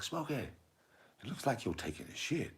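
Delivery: high, slow film character; hammed-up high drawl; Ezel. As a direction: draw it out softly, way up high. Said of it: "He's like".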